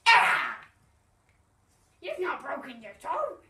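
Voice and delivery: loud cross outburst, then scolding. Acted. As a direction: snap at him crossly. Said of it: "Penguin was very cross".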